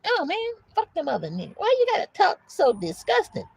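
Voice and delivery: high, whiny mock complainer; offended listener. Objector to the preacher's crude words, mock offended.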